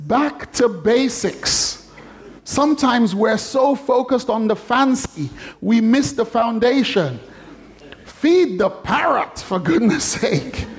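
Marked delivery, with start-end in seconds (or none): 0.1-1.9 "Back to basics" very slow, higher; slow refrain. Shown as a demonstration. Preacher returning to the lesson after the joke, slow. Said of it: "closing refrain". 8.0-10.7 slow, higher, laughing through "for goodness sake"; laughing exhortation. Real, not acted. Preacher wrapping up the parrot joke, laughing.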